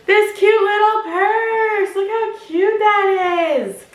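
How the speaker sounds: high, drawn-out squeal of delight; cutesy gushing. Real, not acted. Vlogger showing viewers a cute little purse, high gushing. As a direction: coo slowly in a high, adoring voice.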